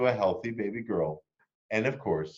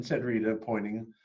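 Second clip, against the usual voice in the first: plain reading voice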